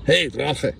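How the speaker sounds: high-pitched called-out yell; shouted. A narrator's reading. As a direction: yell it right into the dragon's ear. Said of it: "hat geschrien".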